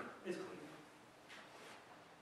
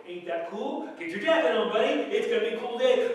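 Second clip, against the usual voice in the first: loud, higher, upbeat call to a buddy; cheerful God; 7 semitones higher, 10 dB louder than usual. Acted. God nudging the preacher to bundle up, loud and cheerful. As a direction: call out like a hearty friend on a cold morning. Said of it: "and God's going".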